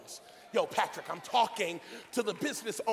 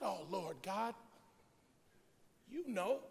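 soft, hesitant-paced reply with pauses; Ezekiel's quiet answer; 9 dB quieter than usual. Acted. Ezekiel answering God's question, quiet reply. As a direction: hand the question back softly. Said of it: "And Ezekiel's response".

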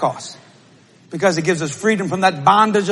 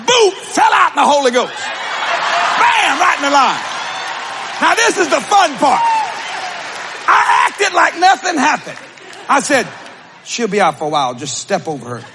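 preaching at full force; 8 semitones higher than usual. Acted. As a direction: shout the freedom out with joy.

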